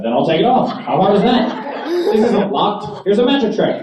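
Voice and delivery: hammed-up furious passenger; mock outrage. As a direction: yell at her in disbelief. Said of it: "I lost my shit".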